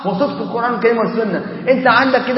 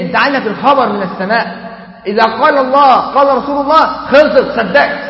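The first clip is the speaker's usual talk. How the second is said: ordinary talk, nothing marked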